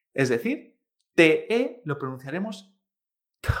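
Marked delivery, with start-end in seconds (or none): 3.4-3.5 "T" with a puff of air before the vowel; aspirated T. Shown as a demonstration. Teacher shows how T E is pronounced, "T" strongly aspirated. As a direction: push air out on "T", then the vowel. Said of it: "sacando aire antes de la vocal".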